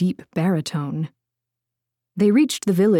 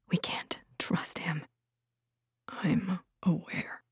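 whispering; 12 dB quieter, slower than usual. A narrator's reading. Secret talk about a traitor.

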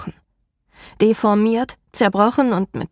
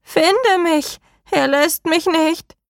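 high, shrill, slow plea; harsh and shrill; 9 semitones higher, slower than usual. A narrator's reading. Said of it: "dann harsch und schrill".

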